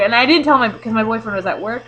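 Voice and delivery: ordinary talk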